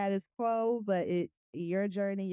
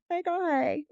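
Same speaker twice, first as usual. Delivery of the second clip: excited greeting; 9 semitones higher, 4 dB louder than usual. Acted. Reading listener letter greeting.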